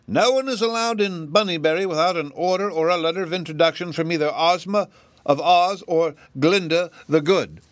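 high, official rabbit proclamation; officious announcement. A narrator's reading. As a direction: announce it like a gatekeeper. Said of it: "announced the rabbit".

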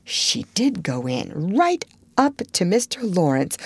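plain reading voice

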